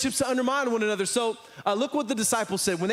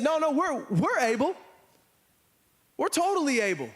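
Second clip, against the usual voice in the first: naive overconfidence; 6 semitones higher than usual. Acted. Disciples overconfidently answering Jesus.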